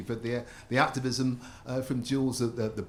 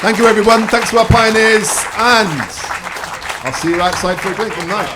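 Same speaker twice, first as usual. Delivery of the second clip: loud sign-off; 9 semitones higher, 16 dB louder than usual. Real, not acted. Host closing over applause.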